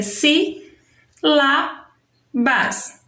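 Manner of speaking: "si", "lá", "bas" each on its own; "si, lá, bas" as three isolated beats; separated syllables. Shown as a demonstration. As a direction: pause after every piece of "si, lá, bas". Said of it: "Se a gente fosse separar, ficaria".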